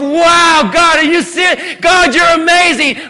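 loud, high amazed praise; awestruck wonder. Acted. What Abraham should have said, amazed and loud. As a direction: gasp in delight at God. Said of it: "should have been a moment of".

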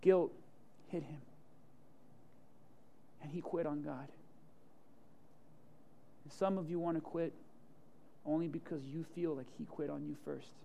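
hushed and sombre. Acted. Pastor telling how Peter quit on God, hushed. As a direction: drop it to a near hush. Quiet sombre moment in sermon.

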